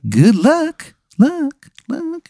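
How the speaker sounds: high voice echoing and fading out; echoing fade. Acted. The Cosmic Cowboy's goodbye echoing off into the night. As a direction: let each luck echo away into silence.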